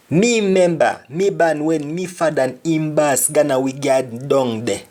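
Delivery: Jamaican patois. Shown as a demonstration. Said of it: "Another example".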